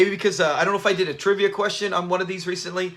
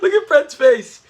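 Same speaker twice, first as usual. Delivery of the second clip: delighted exclaim; 7 semitones higher, 7 dB louder, slower than usual. Real, not acted. Cracking up at the scene.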